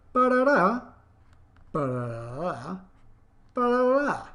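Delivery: ba-da-da sung high, then low and slow, then high. Shown as a demonstration. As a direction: hum the pitch drop and rise. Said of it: "so non-defining relative clauses".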